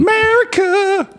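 high, drawn-out America!; mock patriotic cry. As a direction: belt it like a parody anthem. Patriotic punchline.

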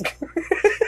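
high laugh. Real, not acted. Laughing at quote.

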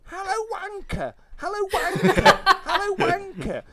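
high falsetto shriek, loud; feminine shriek. Retold or imitated. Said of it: "shriek in a vaguely feminine voice".

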